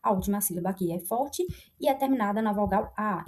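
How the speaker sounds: ordinary talk, nothing marked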